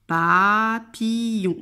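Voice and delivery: "Papillon" very slow, first syllables drawn out. Shown as a demonstration. Teacher tracing arcs for each syllable, "Papillon" slowly stretched. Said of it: "et pour chaque syllabe".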